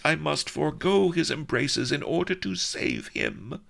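high, torn melodramatic princess; anguished princess. A narrator's reading. Princess torn over her lover, high melodramatic anguish. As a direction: fight your own craving aloud.

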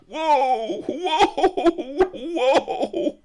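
high shouted cries of woe; hammed-up wail of despair. A narrator's reading. Narrator wailing in mock grief.

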